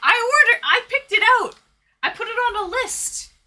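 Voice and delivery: high, loud excited outburst; excited yelling. Real, not acted. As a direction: blurt it out in a flustered rush. Frantic exclamation at chat.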